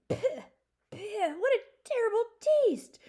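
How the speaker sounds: high, loud disgusted spluttering; choking dragon. Acted. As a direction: spit it out like a foul taste. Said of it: "choked the dragon".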